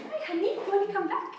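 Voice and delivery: high, sugary call; sweet invitation. Retold or imitated. Wife phoning her husband to invite him back, high and sweet. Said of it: "I phoned him again".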